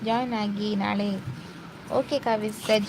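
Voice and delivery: ordinary delivery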